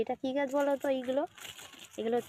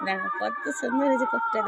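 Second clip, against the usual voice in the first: excited; 12 semitones higher, 5 dB louder than usual. Real, not acted. Delighted showing coffee.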